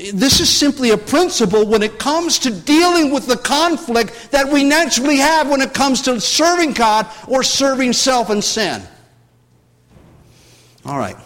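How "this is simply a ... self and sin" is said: raised, emphatic preacher's voice; fervent preaching. Acted. Preacher warns the congregation about unbridled appetites, fervent and raised. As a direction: drive the warning home to the congregation.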